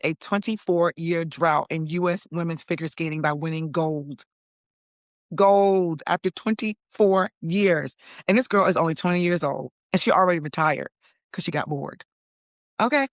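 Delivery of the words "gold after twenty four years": slow, punched-out emphasis; emphatic. Real, not acted. To viewers, celebrating the record win, slow and emphatic. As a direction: lean on every word.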